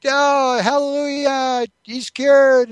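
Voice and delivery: high, loud hallelujah cry; hammed-up joyful exclamation, high and drawn out; mock rejoicing. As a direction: imitate a wife crying out in praise at a miracle. Wife acted rejoicing over cured dog.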